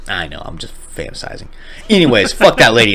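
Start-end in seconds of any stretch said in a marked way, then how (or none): 0.1-1.5 very soft, dropped voice; sheepish murmur. Real, not acted. Admitting to friend the revenge is fantasy, soft murmur. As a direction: concede it under your breath. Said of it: "That ain't gonna work".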